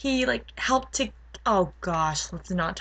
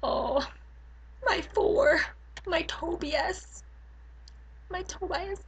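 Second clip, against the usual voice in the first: high, slow, fading fangirl whimper; swooning adoration; 9 semitones higher, 7 dB quieter, slower than usual. Acted. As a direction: pine softly for a beloved crush. Swooning over favorite character.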